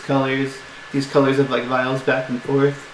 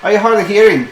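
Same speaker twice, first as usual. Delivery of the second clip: higher, louder mocking question; sarcastic jab; 7 semitones higher, 5 dB louder, faster than usual. Dwarf mistress mocking a mishearing customer, loud. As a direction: needle him about his hearing.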